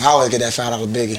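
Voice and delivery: rattled off quickly; fast. Real, not acted. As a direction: say it fast in one breath. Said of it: "Then you can say it fast".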